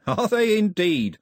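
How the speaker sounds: high, loud, drawn-out mock delight; cheerful villain. A narrator's reading. First mate answers the girl's warning of armed men, cheerfully. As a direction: savour it with gleeful menace. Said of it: "said Cox cheerfully".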